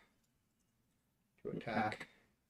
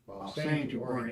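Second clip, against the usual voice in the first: ordinary delivery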